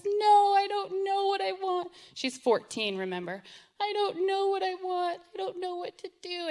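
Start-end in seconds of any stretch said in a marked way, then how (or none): 0.0-1.9 high, loud, tearful confusion; daughter sobbing. Retold or imitated. Daughter bawling on bed. 3.8-6.5 daughter sobbing. Retold or imitated. Daughter bawling on bed.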